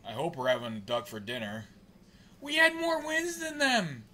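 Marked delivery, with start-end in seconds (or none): 2.4-4.0 very high-pitched protest; indignant whine. Real, not acted. Fan protesting the standings, indignant high whine. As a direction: wail the complaint, pitch held way up.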